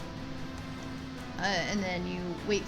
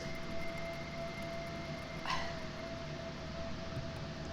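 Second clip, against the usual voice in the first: frustrated groaning; 10 dB quieter than usual. Real, not acted. Struggling to trigger the boss.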